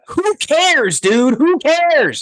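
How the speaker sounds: high-pitched exasperated outburst. Real, not acted. Fed-up host dismisses the quarterback's game outings, exasperated. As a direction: cry it out, fed up, voice leaping way up.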